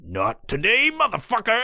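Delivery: taunting, climbing high at the end; voice of his heart. Retold or imitated. Heart to man trying to sleep, taunting. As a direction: play a defiant pounding heart. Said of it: "my heart's going".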